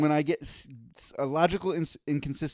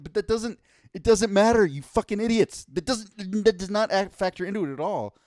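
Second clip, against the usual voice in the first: higher, louder frustrated retort; exasperated rant; 6 semitones higher, 5 dB louder than usual. Retold or imitated. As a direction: snap back at the idiots, fed up. Exasperated reply to fans.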